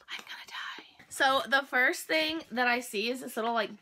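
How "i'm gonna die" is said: soft unvoiced whisper of delight; awed whisper. Real, not acted. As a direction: whisper it, overwhelmed by cuteness. Overwhelmed by cute items.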